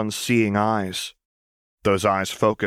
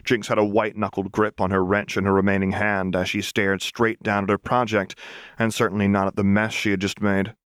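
ordinary delivery, nothing marked

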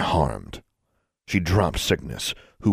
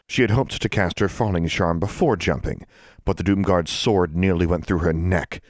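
ordinary delivery, nothing marked

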